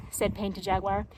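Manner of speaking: ordinary delivery, nothing marked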